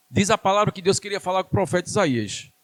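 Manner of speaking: ordinary delivery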